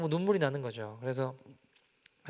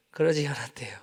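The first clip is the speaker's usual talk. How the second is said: whisper. Acted. Dramatic aside after the story.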